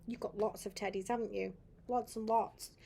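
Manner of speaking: ordinary delivery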